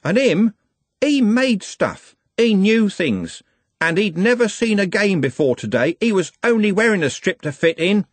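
high, impassioned, angry defence; yelling. A narrator's reading. Trev jabbing a finger at his fallen friend, yelling. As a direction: throw it at them point by point. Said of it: "by yelling at a bunch of men".

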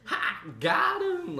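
very high, gloating mock cry; meme imitation. Quoting the huge meme, high gloating imitation. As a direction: crow it like the prank meme. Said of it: "that's when D's nuts was, like, a huge meme".

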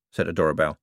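plain reading voice

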